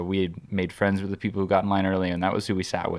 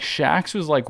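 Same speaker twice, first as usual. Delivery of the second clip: ordinary talk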